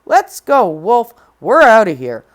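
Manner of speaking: loud, brisk call. Acted. Red calling the wolf to leave, loud. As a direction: summon the wolf loudly.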